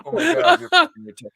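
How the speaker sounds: high, loud mock exasperated wife; laughing imitation of an appalled wife; wife's reaction. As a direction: shriek it like a stunned wife. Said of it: "my wife's looking at me going like".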